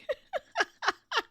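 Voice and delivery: high laugh; amused laugh. Real, not acted. Laughing at cow request.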